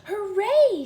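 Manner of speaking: high, loud hooray; gleeful shout; cry of joy. Acted. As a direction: cheer it out like a delighted girl. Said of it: "she cried".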